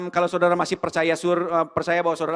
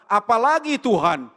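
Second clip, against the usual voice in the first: loud, slow greedy demand for more; greedy asking; 6 semitones higher, 8 dB louder than usual. Retold or imitated. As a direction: whine for more like a greedy child. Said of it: "Greedy kita".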